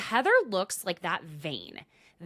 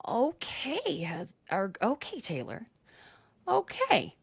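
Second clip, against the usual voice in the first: high, swooping mock okay; miffed reply; 4 semitones higher, slower than usual. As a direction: act out an offended okay, pitched up. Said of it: "And Heather says".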